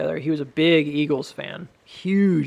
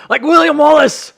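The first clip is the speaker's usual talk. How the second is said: loud, high, hammed-up Scottish cry; over-the-top Scottish warrior accent; William Wallace; 11 semitones higher, 11 dB louder than usual. Shown as a demonstration. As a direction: shout it in a mock Scottish brogue. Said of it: "the Scottish sound like this".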